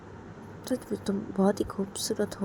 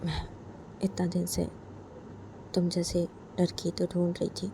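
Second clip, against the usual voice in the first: ordinary talk, nothing marked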